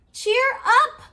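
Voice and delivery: high, loud cheer of a toy clown; high excited shout; cheery cry. A narrator's reading. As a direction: call out bright and high to lift spirits. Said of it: "cried the little toy clown".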